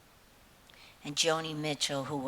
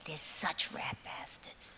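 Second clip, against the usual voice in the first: whispered aside, slow; conspiratorial whisper; 6 dB quieter than usual. Real, not acted. Comedian confiding to Canadian audience, whispered jab. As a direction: drop to a sly whisper for the insult.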